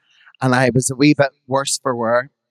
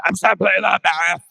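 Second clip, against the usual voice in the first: proud star voice, a little higher; smug diva pose; 5 semitones higher than usual. Retold or imitated. Posing on stage after the crowning, smug diva. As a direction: strike a triumphant diva pose in the voice. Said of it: "I was like".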